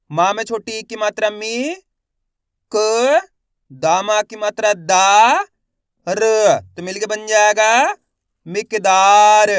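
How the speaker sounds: teacher's drill ending on a loud, slow मिकदार; letter-by-letter sounding out. Shown as a demonstration. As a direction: spell it out syllable by syllable, stretching दा. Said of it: "एक ही अक्षर की पहचान करो, उनका उच्चारण करो".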